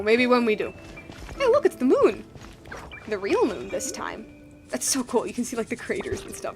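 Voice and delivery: ordinary talk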